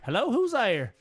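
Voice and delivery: hammed-up radio-drama hello; old-time radio drama voice. Shown as a demonstration. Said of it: "remember old-time radio dramas? Like".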